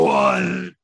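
loud, high booming "What?"; loud giant shout. Acted. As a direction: bellow it right in their face. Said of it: "Like, very loudly in your, in your face".

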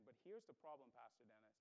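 low, hushed admission; imagined inner thought. Retold or imitated. Churchgoer privately objecting to the pastor, imagined inner thought. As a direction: confess the objection softly, dropping very low. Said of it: "you're thinking to yourself".

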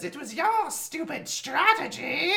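high, slow indignant whine; whiny Starscream voice. Retold or imitated. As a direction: squeal it like an offended robot. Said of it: "Starscream says".

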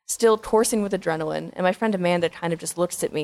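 ordinary talk, nothing marked

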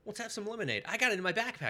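a hint of a pushy salesman; eager salesman. Door-to-door comic seller pitching lemonade, high and chirpy.

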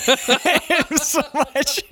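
said through laughter, slow; amused outrage at the dad, laughing through the words. Real, not acted. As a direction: laugh it out in disbelief. Laughing at the father's prank.

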